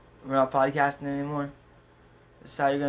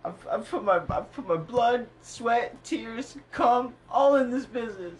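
mock heartbreak; 9 semitones higher, slower than usual. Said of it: "how little Johnny cares".

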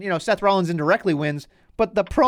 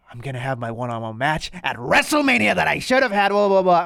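huffing and puffing. Retold or imitated. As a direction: imitate a wrestler huffing through his complaint. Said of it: "he's huffing and puffing and he's like".

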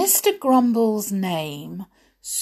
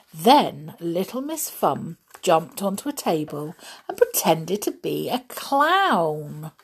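ordinary delivery, nothing marked

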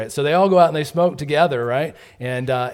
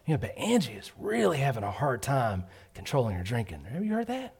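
softer, hushed gossip about Angie; quiet gossip; 7 dB quieter than usual. Retold or imitated. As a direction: confide it behind a hand. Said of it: "gets a little quiet".

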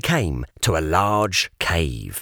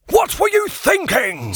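a high, sharp, loud telling-off; scolding; 4 dB louder than usual. A narrator's reading. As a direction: scold the pig like a fussy old guardian. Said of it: "Sir Regulus scolded the pig".

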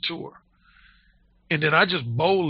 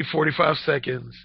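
ordinary talk, nothing marked

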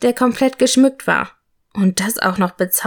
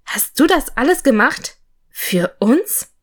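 high, wondering questions; impressed disbelief, lifting at the end; audibly impressed; 5 semitones higher than usual. A narrator's reading. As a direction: ask with rising, touched amazement. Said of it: "hörbar beeindruckt".

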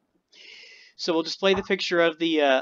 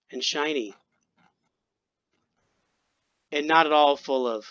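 dramatic story narration. A narrator's reading. Storyteller reading of a being becoming aware, portentous. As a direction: weigh each phrase like a sci-fi prologue. Reads own short story.

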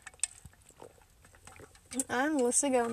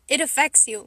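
normal talking voice

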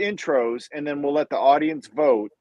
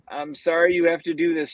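ordinary talk, nothing marked

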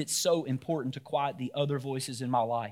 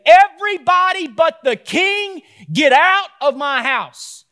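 screaming at the table; 12 semitones higher, 12 dB louder, slower than usual. Pretend. Dad screams at talking family to leave. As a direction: top of the lungs yell, slow and high. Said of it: "at the top of your lungs, scream to your table".

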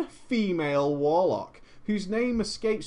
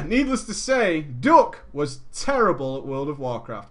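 ordinary talk

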